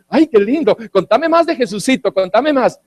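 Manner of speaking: high-pitched, fawning delight; put-on sugary enthusiasm; mock gushing. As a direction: coo like a smitten listener, hammed up. Mocking Félix's delight at gospel.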